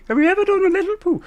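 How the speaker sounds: very high, quick silly question; put-on high voice. Streamer repeating a silly question, high put-on voice. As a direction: squeak it out fast.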